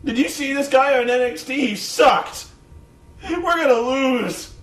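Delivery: loud, high mock wail; hammed-up commentator giving up hope; despairing complaint. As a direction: imitate exasperated doom, slowing on the last words. Acted commentator despairing at match.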